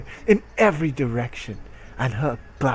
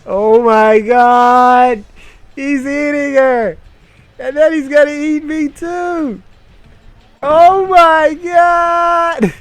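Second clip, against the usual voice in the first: high, loud, drawn-out mock scream; mock horrified shriek; 14 semitones higher, 12 dB louder, slower than usual. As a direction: shriek in pretend terror. Mock horror at the story.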